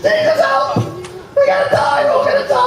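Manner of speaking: very high, shrieking yell for help; high-pitched hammed-up panic of a disciple in a sinking boat; panicked cry.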